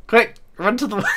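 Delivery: high, laughing call that collapses; laughing urgency. Real, not acted. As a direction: break into squealing laughter mid-sentence. Cracks up while fleeing.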